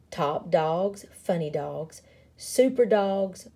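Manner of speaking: plain reading voice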